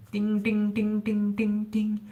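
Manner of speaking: high ding imitation, voice way up; high string sound. Shown as a demonstration. Mimicking the high first string of a guitar. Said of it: "the first string".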